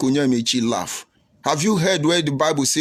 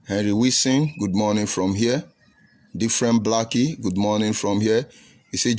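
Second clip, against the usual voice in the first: ordinary talk, nothing marked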